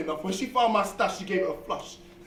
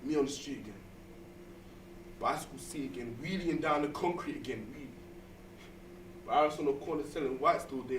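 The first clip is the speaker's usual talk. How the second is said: spoken word poem; 2 semitones lower, 4 dB quieter than usual. Acted. Rhythmic poem piece.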